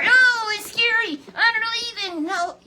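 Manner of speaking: high, loud cry of awe; awed outcry. Real, not acted. Preacher imagines the fiery chariot, loud awed cry.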